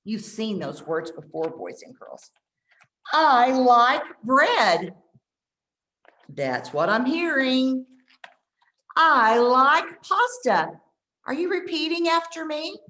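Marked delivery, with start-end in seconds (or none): 3.1-5.0 very slow, louder, clearly spaced; slow repeat-after-me model. Shown as a demonstration. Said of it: "I'll say it and you repeat it". 9.0-10.8 very slow, louder, clearly spaced; slow repeat-after-me model. Shown as a demonstration. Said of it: "I'll say it and you repeat it".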